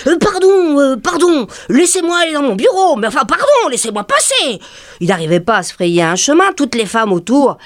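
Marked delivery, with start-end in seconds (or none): 0.1-4.6 higher, stammering, half-laughing pleas; a fussed man begging to get through; lightly voiced, flustered pleading; flustered manager.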